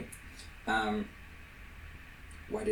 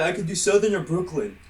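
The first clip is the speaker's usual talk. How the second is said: lightly mocking imitation of a friend, higher; mimicking friend; 7 semitones higher, 6 dB louder than usual. Host mocks friend's boast about doing accents. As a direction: parrot her boast in a teasing voice. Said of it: "He's like".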